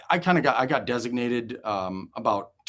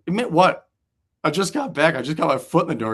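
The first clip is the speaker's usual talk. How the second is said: higher, louder indignant protest; mock indignant; 6 semitones higher, 4 dB louder than usual. Just back from minors, protesting to Casey, indignant. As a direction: act offended and baffled. Surprised denial to teammate.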